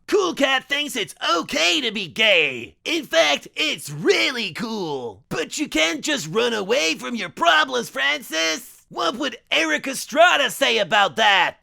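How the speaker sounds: cheesy cartoon cat mascot, encouraging; cartoon mascot lecture. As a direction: preach it like a kids' show hero. Cool Cat lecturing Francis.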